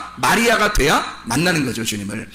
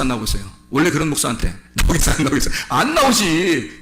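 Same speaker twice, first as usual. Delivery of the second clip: normal talking voice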